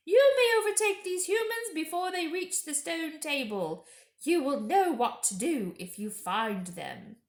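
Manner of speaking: witch's order. Acted. Witch sending Fenris Ulf to kill, commanding. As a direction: order the wolf like a queen. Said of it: "said the witch".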